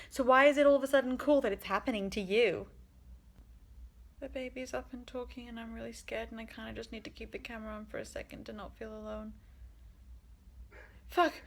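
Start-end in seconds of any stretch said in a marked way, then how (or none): 4.2-9.3 hushed, frightened voicing of the girl; scared whisper. Voicing the scared girl on camera, hushed and frightened. 11.1-11.3 sudden startled swear at a noise; startled curse. Real, not acted. Startled by the dog barking, a sudden real curse.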